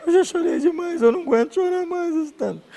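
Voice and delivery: put-on girl begging the pastor not to apologize publicly; young girl. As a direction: plead like a tearful teen girl. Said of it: "Aí ela disse".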